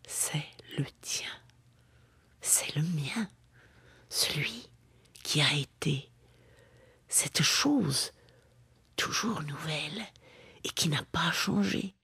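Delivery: very soft, near-whispered verse; hushed intimacy. Acted. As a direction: confide it softly, pausing between phrases. Intimate address within the poem.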